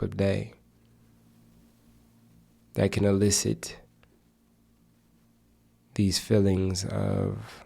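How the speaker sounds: unhurried meditation guide, long pauses; measured, even meditation voice with spacious silences; guided meditation. Acted. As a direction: guide listeners gently into stillness, phrase by phrase.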